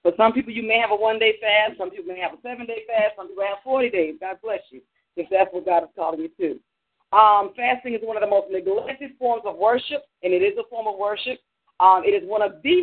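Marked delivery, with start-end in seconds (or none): none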